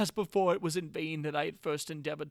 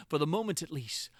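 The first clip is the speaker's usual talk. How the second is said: usual speaking voice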